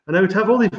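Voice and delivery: normal talking voice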